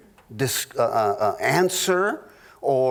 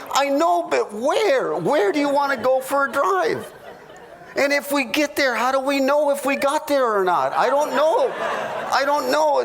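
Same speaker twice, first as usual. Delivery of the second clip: higher, laughing mock plea; higher, hammed-up frustrated husband; pleading husband; 8 semitones higher than usual. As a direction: press her for a destination, pitched up and laughing. Husband's side of mock argument.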